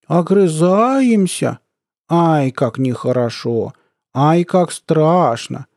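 slow, drawn-out mock scolding. A narrator's reading. Colonel sneering at a soldier's threat, mocking. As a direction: draw it out in fake dismay. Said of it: "издевательски сказал полковник".

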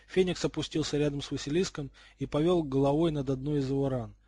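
plain reading voice

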